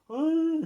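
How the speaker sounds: high-pitched held "ah"; imitated cry. Retelling the jerk's reaction to the smashed table, high mock cry. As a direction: mimic a man's high wail. Imitating the jerk knocked back.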